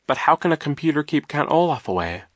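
lightly voiced puzzled girl. A narrator's reading. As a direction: ask it in honest bafflement. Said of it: "Violet asked in a puzzled voice".

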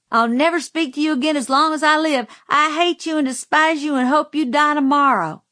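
put-on fast, high, loud child's outburst; furious tantrum. Spanked girl raging at her uncle, furious tantrum. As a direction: hurl it out in one high-pitched rush.

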